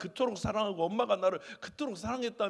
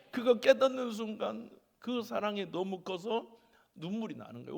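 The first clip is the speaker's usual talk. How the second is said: normal talking voice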